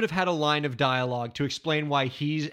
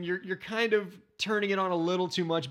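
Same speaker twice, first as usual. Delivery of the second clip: ordinary talk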